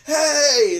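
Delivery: sharp loud high "Hey!"; a teacher snapping the class to order; teacher shout. Retold or imitated. Teacher cutting off the fight, loud shout. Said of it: "Mister fucking Hoffman goes".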